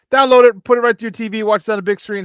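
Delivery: eager host promo; excited pitch. Real, not acted. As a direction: hype the download. Urging viewers to download channel.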